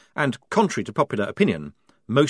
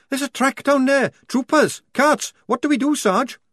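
panicky report; 5 dB louder than usual. Acted. Lofty reporting enemy in panic.

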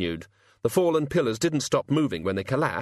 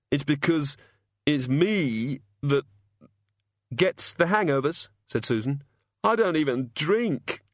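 a dazed, hungover god, slow and halting; lightly voiced sufferer, fumbling for words; groggy hungover god; 5 semitones higher than usual. A narrator's reading.

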